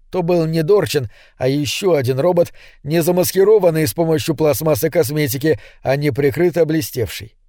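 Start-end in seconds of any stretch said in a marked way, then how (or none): none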